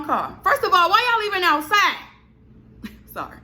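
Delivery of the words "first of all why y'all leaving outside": high, loud, exasperated outburst; real indignation, voice shooting up and loud; upset. Real, not acted. Venting about the tow truck, furious high outcry. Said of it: "I'm upset".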